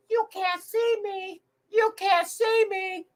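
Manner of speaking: high, slow sing-song taunt; John Cena catchphrase. Pretend. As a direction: taunt like a wrestler. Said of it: "let's play, uh, John Cena".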